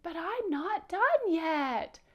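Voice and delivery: high, loud, drawn-out pouty protest; upset whine. Shown as a demonstration. Teacher leading kids to say it like Powder, upset whine. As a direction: demo the puppet's slow, upset whine. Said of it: "Can you say it like him?".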